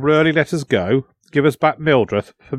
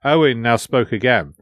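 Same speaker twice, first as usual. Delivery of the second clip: ordinary delivery, nothing marked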